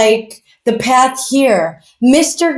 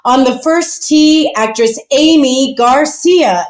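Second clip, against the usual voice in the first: slow announcer introduction, a little pitched up; first-tee announcer; 3 semitones higher, slower than usual. Acted. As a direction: spell out each name like a tee announcer. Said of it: "And then they say".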